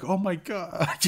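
retold gasp of shock. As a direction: act out a stunned reaction. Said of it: "like, shocked".

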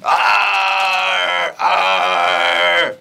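loud puppet roar; angry dinosaur roar. Acted. Puppet dinosaur roaring at creatures, loud angry roar. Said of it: "a very angry dinosaur".

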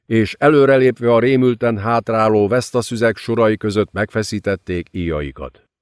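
ordinary delivery

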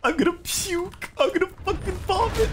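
high, strained groaning complaint; rising exasperated whine; disgusted whine. Real, not acted. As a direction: whine it out, sickened. Disgust at enemy build.

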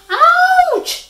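very high, loud yelp; sharp acted howl of pain; pained yell. A narrator's reading. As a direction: cry out as if bitten. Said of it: "yelled the spider".

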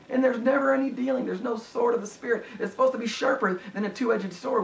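high-pitched, urgent preacher voice; impassioned preaching, pitched well up; fervent preaching. Acted. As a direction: press the warning home from the pulpit. Preaches with raised intensity.

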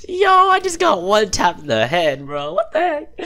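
high, slow, wailing disbelief; shocked outcry. Real, not acted. Gamer wailing after dying to one headshot. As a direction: cry out like you can't believe it.

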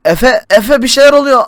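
panicked, gasping for air; can't breathe. Acted. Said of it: "Nefes alamıyorum".

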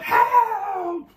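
very high, loud yelp; hammed-up scream of alarm; panicked cry. A narrator's reading. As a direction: shriek for help, long and high. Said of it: "the other one cried".

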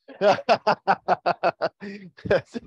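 loud, high run of laughter; hearty laughing. Real, not acted. Pastor joking about married men's prayers, loud high laughter. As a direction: burst out in rolling laughter.

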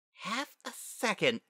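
put-on high, slow child's grumble; grumbling. A narrator's reading. Young Spitfire sore over silver medal, grumbling. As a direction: sulk over each word. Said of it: "she grumbled".

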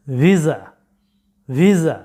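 ভিসা as vee-za, voiced z; correct pronunciation. Shown as a demonstration. Teacher introduces the third word to students, correct pronunciation. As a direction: model ভিসা with a buzzing z, falling. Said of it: "সঠিক উচ্চারণটা হচ্ছে".

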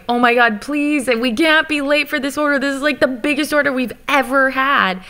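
high-pitched, urgent pleading, re-enacted; retold desperate pleading. Boss begging the late sewing team in a retelling, frantic pleading. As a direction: plead as if begging them not to fail you. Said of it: "like, pleading to yelling".

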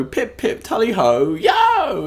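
slow, high posh toff catchphrase; posh English toff. Pretend. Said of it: "it is a joke in my intro".

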